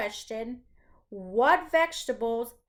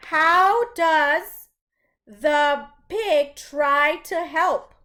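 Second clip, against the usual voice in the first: slow, louder reading of the question; question read aloud; 5 dB louder, much slower than usual. Shown as a demonstration. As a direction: read it out clearly for the class. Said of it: "the first question reads".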